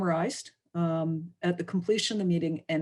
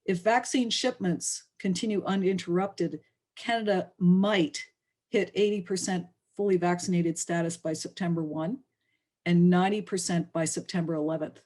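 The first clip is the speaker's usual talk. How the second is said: ordinary delivery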